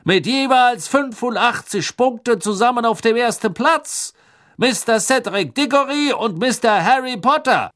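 high, loud booming announcer; magically amplified voice. A narrator's reading. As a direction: announce to the whole stadium. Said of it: "seine magisch verstärkte Stimme hallte".

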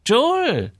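very high-pitched woman's call; shopkeeper woman. A narrator's reading. Shopkeeper Frau Enström greets Joel. (hohe Stimme einer Ladenfrau)

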